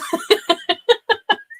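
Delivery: real high-pitched burst of laughter; real giggle, way up high. Real, not acted. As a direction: break into a squeaky laugh. Laughing at her own story.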